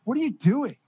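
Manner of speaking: high, incredulous retold outburst; exasperated scolding. Retold scolding of overly rough bouncer.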